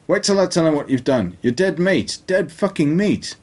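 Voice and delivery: high-pitched, shaky threat, slowing on the last words; high threatening voice. Acted. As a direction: spit the threat out high, dragging the end. Elliot threatens the narrator.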